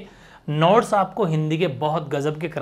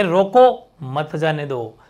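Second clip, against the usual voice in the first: slow "रोको", a long break, then "मत जाने दो"; comma pause; 3 semitones lower, much slower than usual. Shown as a demonstration. Teacher contrasts the meanings, pausing after "रोको" before "मत जाने दो". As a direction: split it firmly after "रोको". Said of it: "यहाँ कह".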